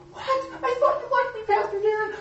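playing a stung girl in the youth group; hurt girl. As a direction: squeak out a shocked, hurt question.

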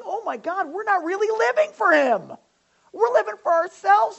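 high, loud imitated wail of remorse; distraught realization. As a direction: cry out like a crowd stricken with guilt. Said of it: "everybody starts crying and weeping".

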